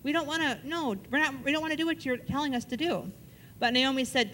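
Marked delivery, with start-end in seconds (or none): 0.0-3.1 lightly voiced, higher pleading daughters-in-law; a touch of tearful insistence, not too big. Daughters-in-law begging Naomi not to send them away, mildly pleading.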